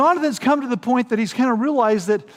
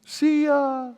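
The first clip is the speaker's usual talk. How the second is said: higher, breezy throwaway; flippant; 7 semitones higher than usual. Pretend. Preacher gives the joking wrong answer, flippant. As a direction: shrug them off as a joke.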